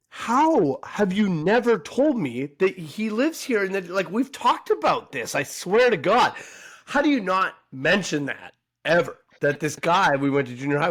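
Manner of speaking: high, emphatic re-enacted scolding; exasperated disbelief. To a mutual friend who never told her, exasperated disbelief.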